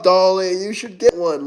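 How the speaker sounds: ordinary delivery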